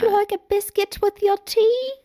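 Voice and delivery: high, loud, mock posh British hostess voice; pinkies-up British accent offering tea. Imitating a British mother offering biscuits, mock posh British accent. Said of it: "pinkies up British tea".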